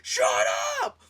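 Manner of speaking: high, loud mock yell at the wall; yelling shut up. Annoyed guest yelling at noisy neighbours, loud and high. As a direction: shout the neighbours down, half laughing. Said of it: "And I'm just like".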